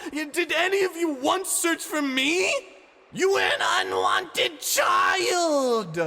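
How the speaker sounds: very high, loud screamed rebuke; enraged shouting girl; furious scream. Acted. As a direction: scream it down at him. Ani's tirade at Ivan.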